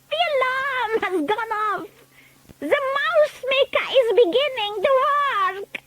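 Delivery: high gleeful witch shriek; triumphant witch. A narrator's reading. Grand High Witch crowing as the spell starts working. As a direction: crow with wicked delight.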